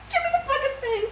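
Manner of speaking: very high, laughing demand; amused, squeaky exasperation; exasperated laughter. Real, not acted. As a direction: crack up while demanding a look. Laughing, demands to see the picture.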